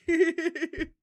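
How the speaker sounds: short high, loud giggle burst; sudden loud laugh; real laugh. Real, not acted. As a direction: let out a quick high cackle. Laughs at the teasing.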